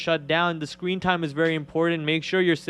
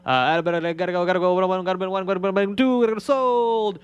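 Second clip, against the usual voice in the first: fast auctioneer chant, higher; 4 semitones higher, faster than usual. Pretend. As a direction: rattle it off like an auctioneer at a sale. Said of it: "sounding like an auctioneer out here".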